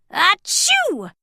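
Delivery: loud high acted sneeze; a big comic sneeze. A narrator's reading. Sneezy sneezing before complaining.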